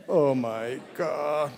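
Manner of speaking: very slow, stretched Oh my God; drawn-out exasperation. Acted. Father at a loss with crying daughter, drawn-out despair. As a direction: sigh it out long in mock despair.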